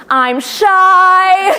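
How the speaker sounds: loud, high-pitched kid imitation; hammed-up kid announcing shyness; mock shy kid. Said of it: "the kids who are like".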